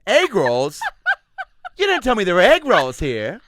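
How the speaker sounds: very loud, high outraged surprise; mock astonished mom. Mom scanning menu with her child, loud astonishment. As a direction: exclaim in hammed-up shock, high and loud.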